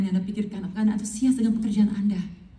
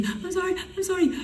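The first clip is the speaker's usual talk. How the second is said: high, whimpering retold apology; tearful apology; 11 semitones higher, 4 dB quieter, slower than usual. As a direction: plead sorry as if crying.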